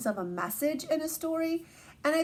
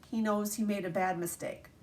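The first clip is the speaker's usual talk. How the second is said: plain reading voice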